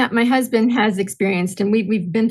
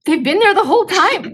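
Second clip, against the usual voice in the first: higher and louder, mock amazed husband; astonished husband; 9 semitones higher, 4 dB louder than usual. Wife imitating her husband realizing the glasses were there, mock amazed. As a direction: play the husband stunned to find his glasses.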